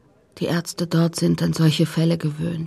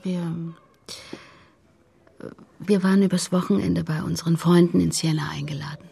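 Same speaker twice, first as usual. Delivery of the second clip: hushed, unvoiced recollection; barely voiced account; whispered telling. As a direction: tell it in a whisper. Mother recounting the accident.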